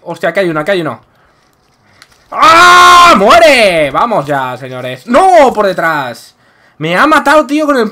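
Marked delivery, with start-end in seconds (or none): 2.3-3.9 raging gamer. Real, not acted. Raging gamer fight yell. 5.1-5.5 raging gamer. Real, not acted. Killed from behind.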